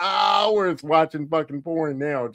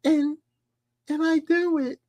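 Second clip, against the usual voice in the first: shy daughter; 12 semitones higher, slower than usual. Retold or imitated. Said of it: "She's like".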